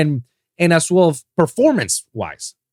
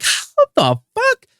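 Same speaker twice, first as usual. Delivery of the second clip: stunned disbelief; slower than usual. Retold or imitated. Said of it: "And you're like".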